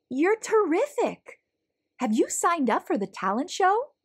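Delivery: high, eager praise; delighted principal. Acted. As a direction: gush over a hidden talent. Principal thrilled by Stanley's dancing.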